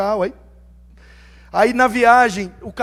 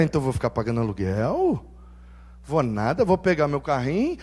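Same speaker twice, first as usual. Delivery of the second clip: a hint of low muttering plan; 8 semitones lower, 6 dB quieter than usual. Inner monologue scheming.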